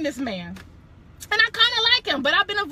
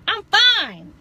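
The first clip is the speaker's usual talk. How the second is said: normal talking voice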